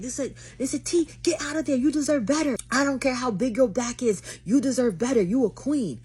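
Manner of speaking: loud, high cheerleading outburst; hyped-up urging. Retold or imitated. Mimicking live viewers telling her to leave, hyped-up urging.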